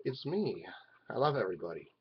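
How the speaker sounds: ordinary delivery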